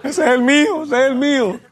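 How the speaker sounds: high-pitched mock proud dad; hammed-up high voice of a boasting father; imitating proud father. Voices his proud crying father, laughing.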